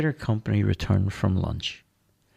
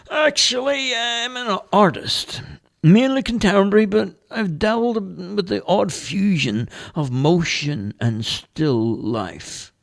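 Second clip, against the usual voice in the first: much higher, louder character voice; Dale's voice; 11 semitones higher, 5 dB louder than usual. Acted. Homeless Dale turning down the priest, high character voice. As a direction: play the street artist, eager and chatty. Dale describes his art.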